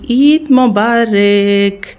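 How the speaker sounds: ईद Mubarak stretched very slow and long; drawn-out greeting. Real, not acted. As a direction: draw out each syllable of ईद Mubarak like a festive call. Festive greeting to viewers. (ईद मुबारक को बहुत धीमे, खींच-खींचकर बोलना)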